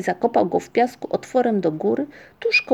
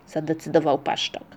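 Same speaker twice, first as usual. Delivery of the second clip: plain reading voice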